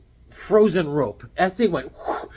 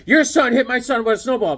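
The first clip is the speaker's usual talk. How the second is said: higher, louder complaining mother; imitating an outraged neighbor mom; indignant mom; 7 semitones higher, 6 dB louder than usual. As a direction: accuse him at the front door. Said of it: "Miss Raccio goes".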